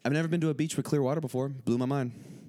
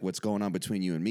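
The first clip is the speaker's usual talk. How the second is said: ordinary delivery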